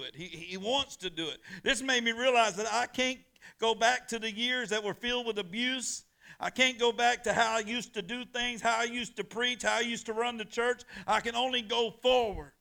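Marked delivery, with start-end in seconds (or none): 1.6-12.5 high, loud fervent declaration; impassioned preaching. Real, not acted. Pastor confessing his past to the church, loud and high. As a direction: pour it out with rising fervour.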